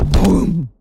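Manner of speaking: usual speaking voice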